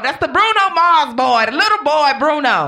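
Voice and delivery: put-on high-pitched Indiana accent that comes out Eastern European; badly faked Indiana accent, sounding Italian; hammed-up pseudo-Italian take on an Indiana accent; attempted Indiana accent. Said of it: "What is this accent you keep giving?".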